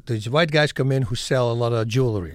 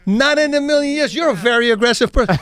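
mock incredulous retort; 8 semitones higher, 5 dB louder than usual. Retells his retort to the boast.

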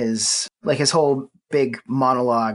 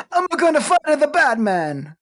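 Mario voice. Retold or imitated. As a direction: bounce it like a video game plumber. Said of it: "slip into a Mario".

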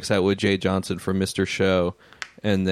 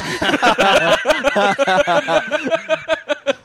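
explosive high-pitched laugh; high burst of laughter; 4 dB louder than usual. Real, not acted. Laughing at uncle comment.

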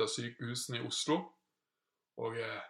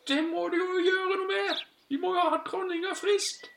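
very high, urgent little bird; insistent tiny bird voice; sparrow; 4 dB louder than usual. Acted. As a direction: squeak it out like a worried sparrow. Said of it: "sa spurven".

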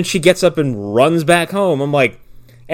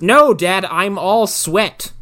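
slow whine; 3 semitones higher, much slower than usual. Retold or imitated. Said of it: "She goes".

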